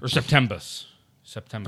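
low "September" with a slurred ending; odd pronunciation. Pretend. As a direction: say "September" oddly, the last syllable loosened to "buh". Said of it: "Don't say it like that ever".

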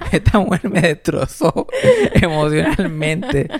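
laughing. Real, not acted.

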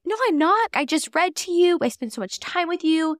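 high, protesting parent's reply; defensive reply. Pretend. Parent to whining toddler, protesting high and defensive. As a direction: protest that you do so spend time.

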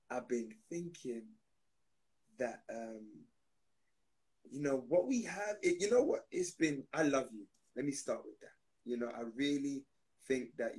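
gentle letdown. Pretend. Gentle breakup speech to banana.

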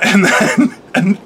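ordinary delivery

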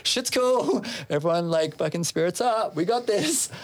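high, falsely upbeat pep talk; rally the crew while hiding the trouble; put-on confidence. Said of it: "a straight face".

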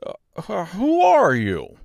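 high, drawn-out question through a stretch; yawny scarecrow voice; stretching and yawning. A narrator's reading. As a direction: ask slowly as if stretching awake. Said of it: "stretched himself and yawned".

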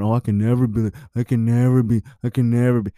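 half-hearted mimic of self-pity; mock whiny complaint. Mocking single women complaining.

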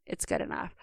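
a whispered "good enough" to yourself; dismissive excuse. Retold or imitated. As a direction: breathe it out like giving up. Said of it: "something I caught myself doing is".

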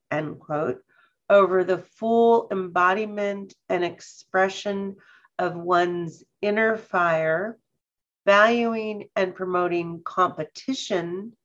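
slow, measured read-aloud, phrase by phrase. Real, not acted. Host reading a colleague's newsletter paragraph to listeners, slowly. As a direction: read slowly, with clear pauses to take it in. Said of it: "hopefully I'll read it slowly enough".

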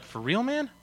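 a high, amazed "for real, man?"; surprised disbelief. Retold or imitated. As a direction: pitch jumps way up on "man". Incredulous reply to invitation.

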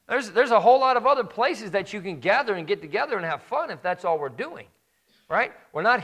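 high, loud pulpit preaching at full force; rising fire-and-brimstone sermon voice; fiery preaching. Acted. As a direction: preach it hard to the congregation.

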